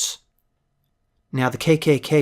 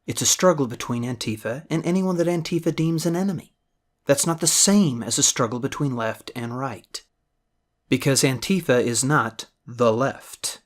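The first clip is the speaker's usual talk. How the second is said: normal talking voice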